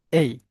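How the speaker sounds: the letter-name vowel "এই"; teacher isolating the "এই" sound; long A sound. Shown as a demonstration. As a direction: demonstrate A as the gliding "এই". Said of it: "এইতে আমরা দুইটা sounds".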